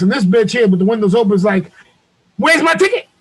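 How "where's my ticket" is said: high-pitched mocking quote; high mimicked voice of the driver; mimicked complaint. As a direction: whine it high, imitating her demand.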